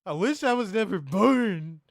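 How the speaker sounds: high, loud, slow soap-opera wail; melodramatic despair. Pretend. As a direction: ham up the tragic lover. Mock soap breakup scene.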